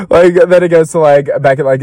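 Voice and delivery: ordinary talk, nothing marked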